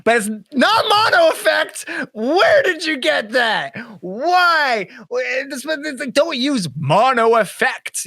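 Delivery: high-pitched, drawn-out ranting; comically outraged disbelief; exasperated. Acted. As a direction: protest in high incredulous wails. Ranting about misused audio term.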